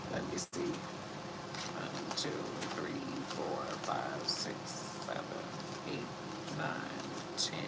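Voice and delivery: counting under her breath in a whisper. Real, not acted. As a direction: whisper the count to yourself, soft and close. Counting cards quietly to herself.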